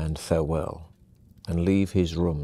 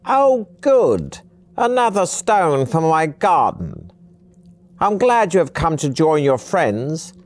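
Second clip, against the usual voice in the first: put-on high, loud, gleeful old trickster; high-pitched, giggling-fool character voice; high-pitched old man; 9 semitones higher, 5 dB louder than usual. A narrator's reading. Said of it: "In a high-pitched voice".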